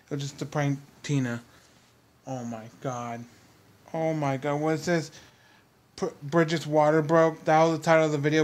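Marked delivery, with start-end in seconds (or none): none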